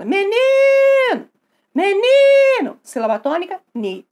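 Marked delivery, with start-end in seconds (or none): 0.0-2.8 high, loud, long-drawn call; calling out shout. Acted. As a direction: yell for someone from outside the house, stretching the stressed syllable. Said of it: "gritando por ela". (gritando para chamar alguém, voz aguda e prolongada)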